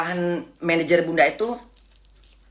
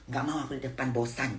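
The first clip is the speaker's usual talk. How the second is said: soft, softer sulky pleading; spoiled whiny voice; 2 semitones lower, 7 dB quieter than usual. Retold or imitated. Mother imitating her spoiled refusal to the boss. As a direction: sulk like a spoiled child, softly. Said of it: "ngomongnya kayak manja-manja gitu".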